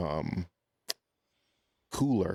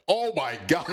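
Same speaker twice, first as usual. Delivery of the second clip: excited gasp; 7 semitones higher, 4 dB louder than usual. Retold or imitated. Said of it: "and I was like".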